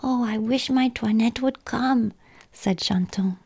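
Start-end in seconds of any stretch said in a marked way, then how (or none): 0.0-2.1 put-on higher-pitched little child's voice; plaintive childlike sigh of a line; wistful small child. A narrator's reading. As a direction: wish aloud like a longing little one. Small child wishing for Toinette.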